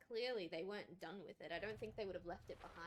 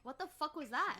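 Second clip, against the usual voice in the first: louder, higher startled shout; shocked outburst; 7 semitones higher, 6 dB louder than usual. Real, not acted. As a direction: blurt it out in shock. Jump scare in game.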